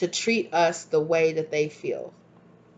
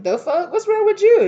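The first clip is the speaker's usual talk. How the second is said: high, loud indignant yelp; outraged shriek; 14 semitones higher, 8 dB louder than usual. Retold or imitated. The rejected person yelling at you, high and loud. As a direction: shriek it in shocked disbelief. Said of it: "They're like".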